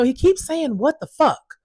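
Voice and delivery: quoting staff, scandalized. Retold or imitated. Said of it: "And they said".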